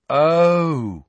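sudden understanding, voice lifted; dawning comprehension. A narrator's reading. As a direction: draw it out as it sinks in. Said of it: "comprehension dawning".

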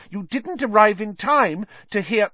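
high-pitched, earnest pupil. A narrator's reading. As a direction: plead your point earnestly, pitched high. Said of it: "said Hermione earnestly".

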